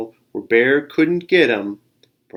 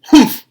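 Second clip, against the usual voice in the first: sharp, loud, high scoffing humph; grumpy bear humph; 13 dB louder than usual. Acted. The bear scoffing at the squirrel, loud grumpy humph. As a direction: snort it out like a sulky bear.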